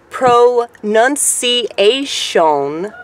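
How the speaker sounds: "pronunciation" very slow and high, every syllable stretched; loud, drawn-out "pronunciation", syllable by syllable; exaggerated pronunciation. Shown as a demonstration. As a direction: overdo "pronunciation" as pro-nun-see-ay-shone. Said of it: "But the correct pronuncia".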